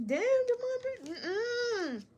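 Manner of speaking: very high, tearful wail of exclamation; crying. Real, not acted. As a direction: cry out, overwhelmed by real tears. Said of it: "Divine Feminine see me cry".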